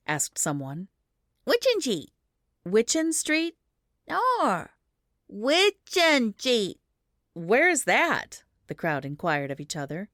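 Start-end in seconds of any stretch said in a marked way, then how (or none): 1.4-6.8 put-on small boy, high, drawing the street name out; a toddler spelling it out slowly; insistent child voice. A narrator's reading. 7.3-8.4 puzzled crowd. A narrator's reading. Crowd inquires.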